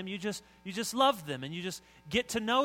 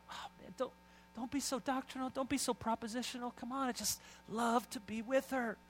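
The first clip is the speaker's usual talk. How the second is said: high, softer, pleading swoon; mock gushing; 8 semitones higher, 4 dB quieter than usual. Husband dodging his mother-in-law's question, mock gushing. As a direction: plead dreamily, brushing the question off. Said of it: "Some of us talk that way".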